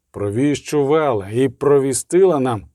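ordinary delivery, nothing marked

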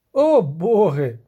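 loud, slow, drawn-out exclamation; astonished groan. A narrator's reading. Bard amazed at the spell, loud drawn-out groan. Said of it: "простогнав із подивом Любисток".

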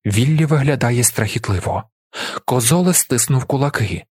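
usual speaking voice

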